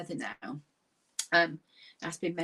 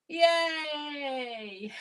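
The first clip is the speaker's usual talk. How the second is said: laughing cheer; 6 semitones higher than usual. Shown as a demonstration. Cheering class, laughter yoga.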